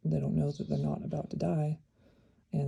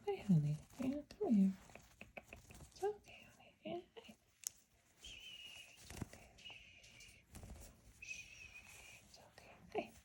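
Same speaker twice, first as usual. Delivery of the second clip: high, hushed cooing; soothing a pet; 10 semitones higher, 12 dB quieter than usual. Real, not acted. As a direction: coo softly to calm her. Calming the restrained bird.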